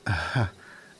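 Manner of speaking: a quick, caught-off-guard chuckle; short surprised laugh. Acted. Servant laughing at the queen's bawdy remark. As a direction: laugh despite yourself, briefly.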